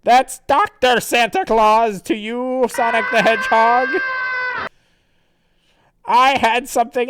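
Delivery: high, loud pompous villain; grandly theatrical villain commands; Santa Claus. Acted. Villain sends his robot against Sonic, pompous and loud. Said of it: "And then Santa Claus says".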